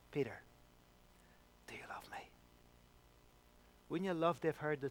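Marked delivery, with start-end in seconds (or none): 0.1-2.3 hushed to a whisper; whispered plea. Retold or imitated. Jesus asking Peter a third time, whispered. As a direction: let it fall to a tender whisper.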